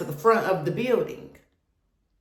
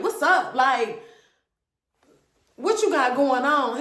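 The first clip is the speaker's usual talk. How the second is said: higher, pushy challenge; demanding; 6 semitones higher than usual. Retold or imitated. Woman demanding answers from her boyfriend, high and pushy. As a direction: confront him with sharp questions. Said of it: "I'm like".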